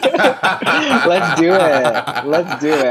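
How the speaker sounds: loud, through real laughter; real laughing enthusiasm; laughing agreement. Real, not acted. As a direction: agree eagerly while cracking up. Laughing along with the joke.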